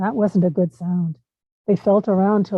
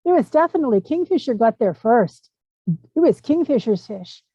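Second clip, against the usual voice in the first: much higher, excited crowd shout; yelling; 6 semitones higher than usual. A narrator's reading. Bird people yelling across the river, insistent. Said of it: "all the bird people were yelling".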